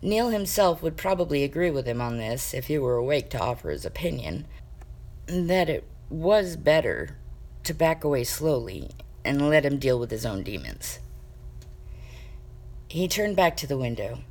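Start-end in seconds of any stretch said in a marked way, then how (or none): none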